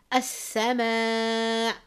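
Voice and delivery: "as-sama" very slowly with the long vowel stretched; drawn-out recitation. Shown as a demonstration. Teacher demonstrating, recites "as-sama" slowly with stretched madd. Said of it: "you can see two words here".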